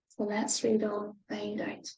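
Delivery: hushed whisper. Real, not acted. Reader whispering to children before reading on. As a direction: lean in and whisper the invitation.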